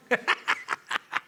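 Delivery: airy chuckle in short bursts; laugh. Real, not acted. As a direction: chuckle breathily at your own joke. Joking about John versus Juan.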